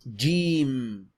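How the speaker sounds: Jeem said loud, long ee, closing on m; teacher modelling Jeem; Arabic letter Jeem. Shown as a demonstration. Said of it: "last sound is like an M".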